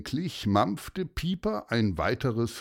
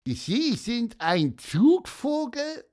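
high, slow amazed question; surprised; 12 semitones higher, slower than usual. Acted. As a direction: ask in squeaky wonder. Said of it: "wunderte sich Gonzo Alexander".

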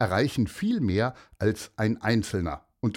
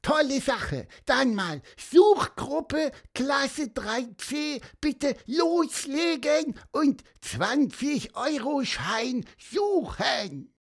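very high, slow peeping bird voice; squeaky bird voice; slower than usual. Acted. Pieper the bird sending class three C off to search, squeaky and very high. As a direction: chirp it out like a tiny bird giving orders. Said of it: "Pieper piepste".

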